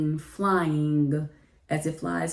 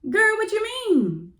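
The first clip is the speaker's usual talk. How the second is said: much higher, louder mock-cry; panicked squeal; 5 dB louder, slower than usual. To the principal on her text, high panicked squeal. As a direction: squeal it in comic alarm. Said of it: "And I was like".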